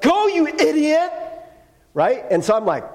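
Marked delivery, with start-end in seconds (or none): 0.0-1.1 high, loud mock yell at another driver; angry driver yell. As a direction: bark it like a furious driver out the window. Angry driver yelling out window.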